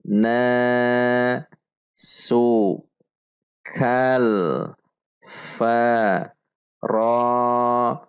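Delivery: each syllable set apart with pauses; drill syllables. Shown as a demonstration. Teacher drills each syllable for students. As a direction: clip each sound separately, like a drill. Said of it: "Mari perhatikan panjang dan pendek bacaan".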